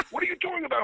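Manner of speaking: very high mock-angry demand; yelling boss. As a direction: fire the question back as the irate boss. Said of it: "Why are you yelling at me".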